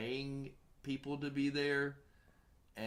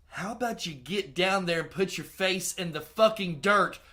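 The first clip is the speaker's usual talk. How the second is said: loud, high, furious sarcastic plea; angry yelling; 9 semitones higher, 15 dB louder than usual. Retold or imitated. Director yelling at stubborn actress, furious shout. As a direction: yell at her to just do it, mock-polite.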